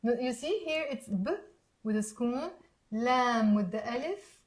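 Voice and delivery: ordinary delivery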